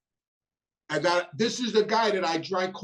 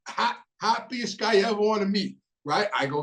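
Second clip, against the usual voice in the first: usual speaking voice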